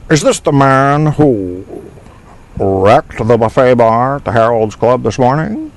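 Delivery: slow drawn start in the film character's voice; movie character impression. Host repeats the movie quote for the chat in a light character voice. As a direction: say it just like him. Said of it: "I said it pretty much just like him".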